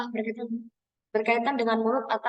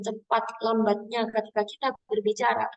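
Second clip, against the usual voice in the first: ordinary talk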